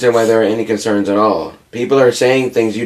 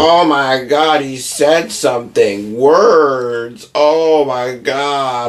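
high, slow, hammed-up horror; mock outrage; 4 semitones higher, much slower than usual. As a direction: drag it out as if scandalized. Mocking sensitive people.

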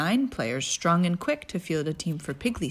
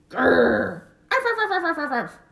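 yappy chihuahua voice; 9 semitones higher, 9 dB louder than usual. Acted. Growling barking dog.

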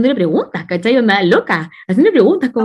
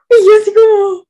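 shocked exclamation; 10 semitones higher, 6 dB louder than usual. Real, not acted. Shocked at his outburst.

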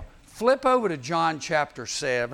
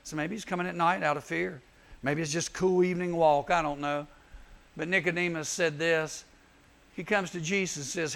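usual speaking voice

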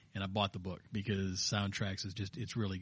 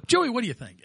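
mock host question; 12 semitones higher, 8 dB louder than usual. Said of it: "waiting for a point where I say".

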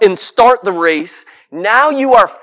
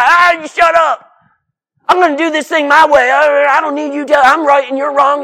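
higher, fast, defiant retort to critics; mock angry yelling back; 5 semitones higher than usual. Preacher mimics believers yelling back at critics, mock angry. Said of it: "they start yelling at the people".

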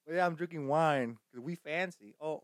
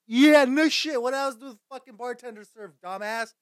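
loud, higher-pitched mock scolding; 7 semitones higher, 7 dB louder than usual. Podcaster heckling an imagined listener's question, mock scolding. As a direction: snap back loudly at a daft question.

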